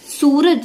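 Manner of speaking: सूरज with a long oo, said loud and high; सूरज with the long vowel. Shown as a demonstration. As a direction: stretch the soo in सूरज. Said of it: "सूरज not छोटे ऊ की मात्रा".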